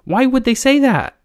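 very high, loud, alarmed demand; fearful question, voice shooting up; frightened. A narrator's reading. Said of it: "frightened anew".

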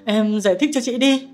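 stunned, begging to understand; shocked exclaim. A narrator's reading. Shocked sister demands explanation.

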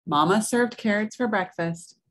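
plain reading voice

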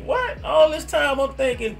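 high surprised yelp; shocked what. Retold or imitated. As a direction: squeak it out in disbelief. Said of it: "I was like".

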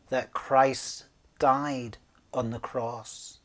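ordinary delivery

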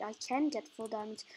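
usual speaking voice

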